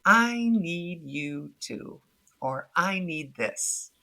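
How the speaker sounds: slow, measured request; a slow model line for setting a limit; slow, calm demonstration. Shown as a demonstration. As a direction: lay out each word slowly and calmly. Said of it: "you say things like".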